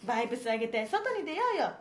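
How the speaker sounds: japanese gyaru slang. Acted. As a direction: read it like a hyper teen gyaru. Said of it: "このキャラムカつきまるな".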